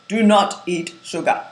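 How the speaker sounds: put-on slow, firm Indian accent; a stern elder speaking in an Indian accent; Gandhi's Indian accent. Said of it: "I hope my Indian accent is okay".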